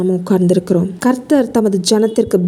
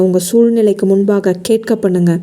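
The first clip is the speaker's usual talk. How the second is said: ordinary talk, nothing marked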